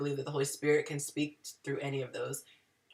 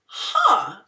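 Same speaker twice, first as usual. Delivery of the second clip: inner Karen huh; 3 semitones higher, 8 dB louder than usual. Shown as a demonstration. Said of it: "I call it my inner Karen".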